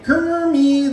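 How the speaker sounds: high, loud call of the name; a Piggy-style holler; Miss Piggy calling. Shown as a demonstration. Hypnotist reminds the volunteer of Miss Piggy's cry, high and loud. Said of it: "start yelling for Kermie".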